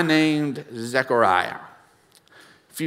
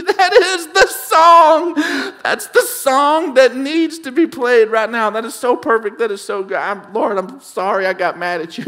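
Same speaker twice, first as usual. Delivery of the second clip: excited prayer; 10 semitones higher, 6 dB louder than usual. Retold or imitated. Retold prayer, laughing at self.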